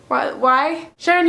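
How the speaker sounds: ordinary talk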